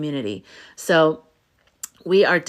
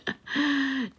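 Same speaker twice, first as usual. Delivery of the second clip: soft laugh; 6 semitones higher, 8 dB quieter than usual. Real, not acted. Laughs at her joke.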